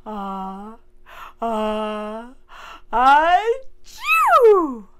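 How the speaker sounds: building up to a loud sneeze; ah, ah, then a big sneeze; about to sneeze. Shown as a demonstration. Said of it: "You go like this".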